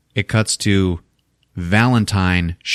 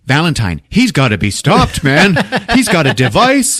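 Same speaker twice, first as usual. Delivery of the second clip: rising alarm; 8 semitones higher, 5 dB louder than usual. Retold or imitated. Harry's alarm building up.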